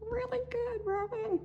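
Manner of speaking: very high, soft put-on voice; tiny squeaky reply in falsetto; Carl. Host answering herself as Carl, high soft character voice. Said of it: "What do you think, Carl?".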